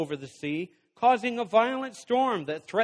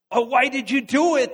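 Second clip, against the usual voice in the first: groaning lament; 5 semitones higher, 4 dB louder than usual. A narrator's reading. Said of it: "they groaned".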